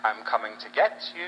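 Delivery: low start swooping up at the end; teasing sing-song threat, pitched low then leaping up; playful menace. Retold or imitated. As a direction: taunt them with a low, creeping threat that lifts on the last word. Speaker taunts the caller as parting shot.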